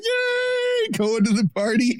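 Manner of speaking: squealed high, giddy mock cheer; mock cheerful. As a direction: play the naive happy tourist. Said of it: "they're like".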